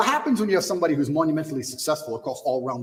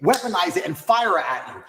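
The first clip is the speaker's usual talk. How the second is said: normal talking voice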